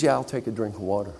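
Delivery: worn-out Pete taking water; tired acceptance. Acted.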